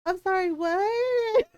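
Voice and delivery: high, drawn-out bewildered question; slow, high-pitched baffled clerk; stunned customer service girl. Retold or imitated. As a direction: imitate a clerk taken aback, voice rising. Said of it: "the poor customer service girl".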